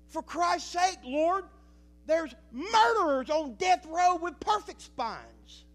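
high, loud anguished cry to God; preaching at full force. Acted. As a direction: hurl the questions at God from the pulpit. Pastor voices his cry to God.

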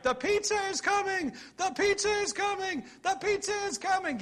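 high excited holler; shouting the big news across the room. Pretend. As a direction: holler it out like the pizza's here. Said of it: "if I began to holler out tonight".

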